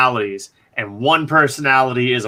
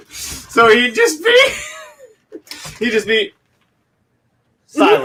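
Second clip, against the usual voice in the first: giggling through the words; laughing so hard it breaks up. Real, not acted. Laughing at the silent mime idea.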